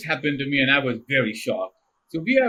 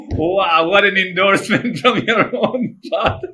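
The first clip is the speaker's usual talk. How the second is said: loud, wry, laughing through it; mock-impressed at a father's jab; laughing sarcasm; 5 semitones higher, 6 dB louder than usual. Sarcastic about dad's verdict.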